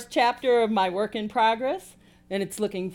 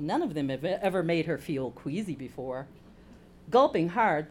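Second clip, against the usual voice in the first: ordinary delivery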